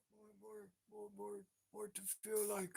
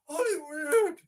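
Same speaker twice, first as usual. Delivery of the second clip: high, loud Hollywood with a rising end; rising shouted Hollywood; 5 semitones higher, 13 dB louder than usual. Pretend. Chanting Hollywood, building up.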